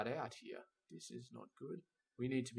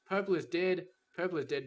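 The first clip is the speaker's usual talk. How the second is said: louder, higher cry of dismay; purple's dead, a loud groan of frustration; exasperated exclamation; 6 semitones higher, 5 dB louder than usual. Real, not acted. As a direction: announce the dead line in a burst. Exclaims at dead production line.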